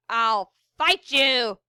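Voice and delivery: slow, menacing pirate snarl. A narrator's reading. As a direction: snarl the threat. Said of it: "he snarled".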